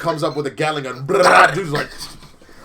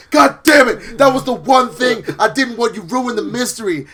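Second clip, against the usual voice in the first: mock furious outburst; 9 semitones higher, 8 dB louder than usual. Joker furious at reveal.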